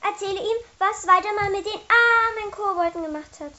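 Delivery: high, loud indignation; angry urging. Acted. Mathilda demands the poor goblins' story be told, angrily. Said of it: "Vor Ärger hatte sich rote Flecken".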